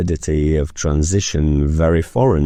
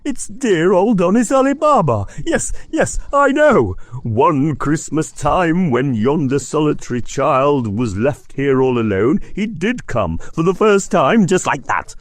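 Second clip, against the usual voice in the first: ecstatic rush; 10 semitones higher than usual. Acted. Scrooge reliving his lonely school Christmas, ecstatic. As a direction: gush like a boy seeing an old friend. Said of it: "Scrooge exclaimed in ecstasy".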